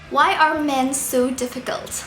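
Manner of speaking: usual speaking voice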